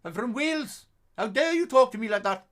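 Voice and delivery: put-on high, loud indignant Welsh accent; high, loud offended Welsh accent; angry Welshman. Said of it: "He got so mad at me".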